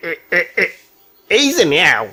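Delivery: put-on old grandfather. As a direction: speak like a crafty old dadu. Old man hands over envelopes. (অনেক নিচু গলায় চালাক বুড়ো দাদু)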